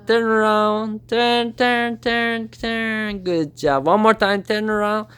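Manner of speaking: high, sing-song repeated commands; high coaxing to Diego; pet training talk. Real, not acted. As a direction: coax the bird round and round. Training the parrot to turn.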